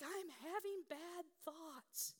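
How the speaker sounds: put-on high, slow wail of a little girl; imitated hysterical child. Seven-year-old daughter to her mother, imitated hysterical wailing. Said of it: "she's hysterical".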